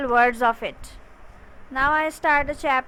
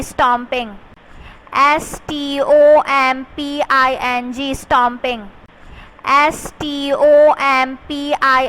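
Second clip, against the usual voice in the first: loud spelling drill; 4 dB louder than usual. Shown as a demonstration. Teacher drilling fifth class on stomping, calling the letters out loud. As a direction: call each letter out louder in a classroom chant.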